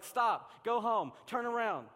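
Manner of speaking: high, loud, insistent body begging to quit; pleading body voice. Retold or imitated. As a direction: let the body beg you to go home. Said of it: "your body's like".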